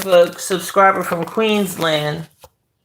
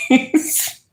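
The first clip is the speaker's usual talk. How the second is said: giggled high through a laugh; 8 semitones higher, 3 dB quieter than usual. Real, not acted. Reading a fan's flirty request aloud, giggling. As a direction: laugh the word out.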